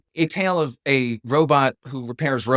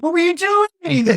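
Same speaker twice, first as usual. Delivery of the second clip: much higher, slow and louder, baffled; incredulous squeak; 6 dB louder, slower than usual. Retold or imitated. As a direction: squeak it out in disbelief.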